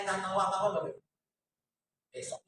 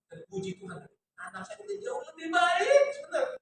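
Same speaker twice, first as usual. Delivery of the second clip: voice breaking with emotion; 9 semitones higher than usual. Real, not acted. Mother thanking God that her son is better, tearful. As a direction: let the gratitude break through the voice. Testimony of child's healing.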